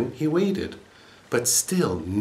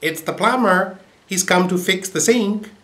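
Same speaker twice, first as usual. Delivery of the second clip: much higher parrot mimicry; parrot repeating; 10 semitones higher, 3 dB louder than usual. A narrator's reading. Parrot answering the old woman, high mimicking voice. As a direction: parrot copying the plumber. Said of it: "And the parrot replied".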